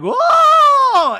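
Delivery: long, high, loud "whoa"; piercing whoop, held out; imitated crowd scream. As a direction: shriek like a whole excited crowd. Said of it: "everybody's screaming".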